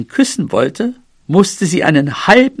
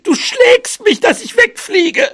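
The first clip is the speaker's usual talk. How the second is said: extremely high, squealed mouse accusation; scolding. A narrator's reading. Mouse flung over trees, squealing and scolding elephant. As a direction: squeal the accusation in tearful outrage. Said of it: "schimpfte sie".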